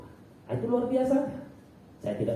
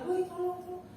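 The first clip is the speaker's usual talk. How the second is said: imitated call for help; 12 semitones higher, 4 dB quieter than usual. Imitating a distant cry for help.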